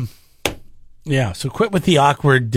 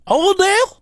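put-on Bakersfield accent. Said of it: "It's kinda southern and it's kinda redneck".

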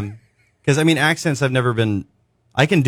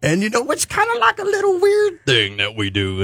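imitating [name]; 13 semitones higher than usual. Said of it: "he's like".

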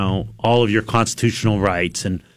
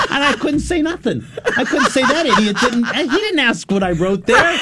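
laughing; 11 semitones higher than usual. Real, not acted. Laughing at her own embarrassment.

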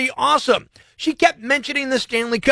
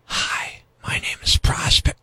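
creepy whisper; 5 semitones lower, 3 dB quieter than usual. Shown as a demonstration. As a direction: whisper your own name like a creep. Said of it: "I kinda hear it".

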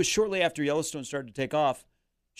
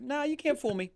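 put-on businessman Tommy Boy; 13 semitones higher than usual. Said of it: "and be like".

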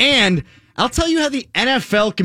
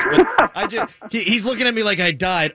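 usual speaking voice

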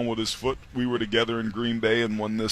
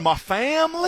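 put-on Southern accent; 3 dB louder than usual. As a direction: stretch it in a mock Southern accent. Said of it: "And the Southern accent".

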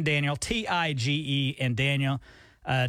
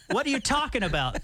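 laughing, higher-pitched disbelief; amused protest; 6 semitones higher than usual. Real, not acted. Teased host answers co-host's seashell jab, laughing.

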